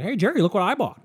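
high, lightly voiced neighbor bragging; mock proud neighbor. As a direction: show off a new toy. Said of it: "he's like".

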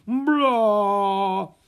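high, loud, drawn-out brassy "blah"; mock trombone blare. A narrator's reading. Trombone playing again after the fumble, blaring. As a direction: honk it long like a trombone. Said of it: "the trombone played".

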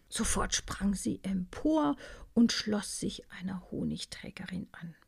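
plain reading voice

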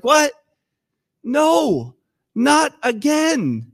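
high, loud cry of "what", then a slow "not again"; dismayed disbelief. Acted. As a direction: voice the disciples' shock. Disciples' dismay at Jesus leaving.

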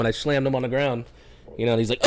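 usual speaking voice

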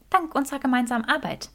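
high, fast female voice; Granger adding quickly. A narrator's reading. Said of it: "ergänzte Granger".

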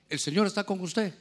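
ordinary delivery

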